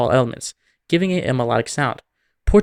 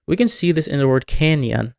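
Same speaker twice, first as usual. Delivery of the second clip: ordinary talk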